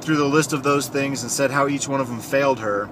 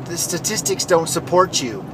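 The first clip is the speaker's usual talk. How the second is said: higher, put-on mocking voice; imitated Democratic senator; 5 semitones higher than usual. Senator dismisses the victim's testimony, in a higher mock voice. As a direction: mimic a senator dismissing someone. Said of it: "And then she says".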